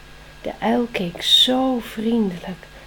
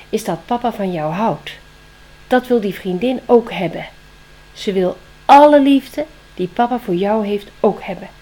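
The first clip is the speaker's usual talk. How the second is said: owl voice swelling much louder; the owl drives the point home, louder; wise owl; 7 dB louder than usual. Acted. Wise owl tells Spring about the jealous girlfriend, growing loud.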